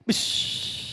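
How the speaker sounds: unvoiced hissing burst of anger; sudden breathy pssh; sharp whoosh. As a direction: breathe out a quick bish as temper flares. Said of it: "Emosi saya tiba-tiba".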